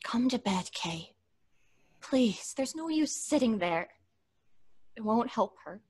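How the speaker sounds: hushed plea. Acted. Florence hushed, begging Kay to come to bed. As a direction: plead gently, barely above a breath. Weary pleading late at night.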